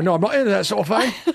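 comic put-on Cockney refusal; playful Cockney catchphrase; Cockney accent. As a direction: turn them down in a mock Cockney voice. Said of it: "a cockney sounds".

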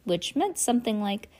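ordinary delivery, nothing marked

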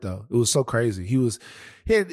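normal talking voice

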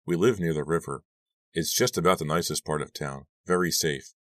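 usual speaking voice

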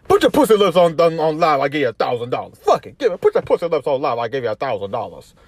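a hint of [name], loud at first; [name] quote. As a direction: shout the offer like a livestream hype. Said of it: "[name] has been saying".

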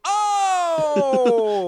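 very high, loud, held "oh"; hammed-up wounded holler; mock Italian outburst. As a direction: wail it like an affronted wise guy. Italian child's outburst in skit.

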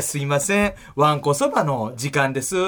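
すいません low, わんこそばの時間です slow with rising end; disputed line intonation. Retold or imitated. Comedian replaying the endlessly drilled soba line, disputed intonation. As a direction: draw out わんこそばの時間です and lift the end. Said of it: "あの言い方めちゃくちゃ".

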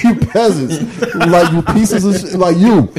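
laughing, slow mock-posh insult; playful hammed-up snob; snooty first-class voice. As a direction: look down on the peasants. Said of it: "I'ma talk like this".

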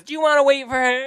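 high-pitched southern waitress voice; southern twang. Retold or imitated. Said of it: "she got a southern twang and she goes".

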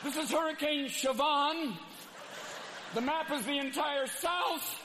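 high, panicky anchor voice, slowing; a forecaster losing it on air; freaked-out weatherman. Acted. A TV weatherman panicking at the hurricane map. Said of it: "the guy in the Weather Channel is freaked out".

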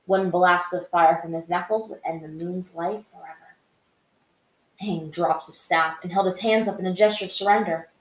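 dramatic fan fiction narration; story narration. A narrator's reading. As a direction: read the chapter out like an audiobook. Reads the fan fiction chapter.

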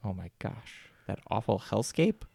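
usual speaking voice

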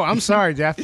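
normal talking voice

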